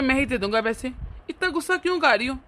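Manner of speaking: usual speaking voice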